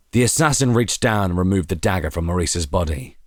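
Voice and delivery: ordinary delivery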